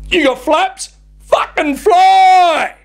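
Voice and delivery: yelled, high, slow on "Fucking fly"; mock yell. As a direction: bark it out like a fed-up driver. Driver's imagined retort to passengers.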